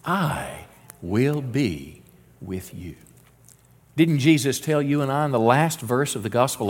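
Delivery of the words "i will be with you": drawn out and quiet, fading to almost nothing; slow soft repeat. Shown as a demonstration. Pastor leading the church in repeating the promise, soft and slow. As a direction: say it slowly with the congregation. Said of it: "Can you say that with me?".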